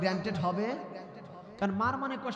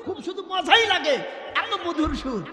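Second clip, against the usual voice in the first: delighted praise; 7 semitones higher than usual. Real, not acted. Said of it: "praising the singer's flute voice".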